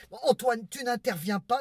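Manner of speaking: loud, high snap at the husband; sharp rebuke. Acted. Wife loudly silencing husband Antoine, irritated. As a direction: cut him off, loud and high.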